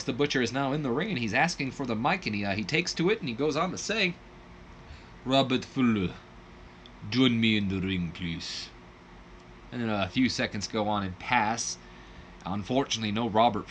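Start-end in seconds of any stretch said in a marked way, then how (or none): 5.2-8.7 lightly put-on Russian accent, low; a hammy heel calling out in fake Russian; bad Russian accent. The Butcher calling Robert Fuller to the ring, mild fake Russian accent. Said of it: "Horrible Russian accent".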